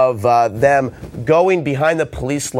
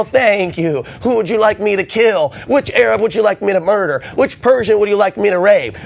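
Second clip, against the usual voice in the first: mock eager soldier; 5 semitones higher than usual. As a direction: sound like an eager servant asking whom to kill. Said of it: "going into the military".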